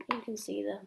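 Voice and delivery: plain reading voice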